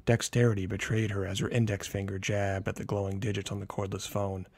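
ordinary delivery

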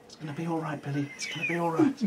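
a touch of soothing parent; mock reassuring. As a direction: gently comfort the scared boy. Comforting the boxed child.

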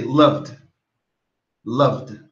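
"loved" in one syllable, luv-d. Shown as a demonstration. Teacher models "loved" with only a D at the end. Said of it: "como se fosse só o D".

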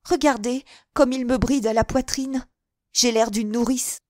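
a haughty client fussing over her coat; irritated lady customer. A narrator's reading. Henriette grumbling to the shopgirl about the coat, peevish. Henriette complains, voiced high.

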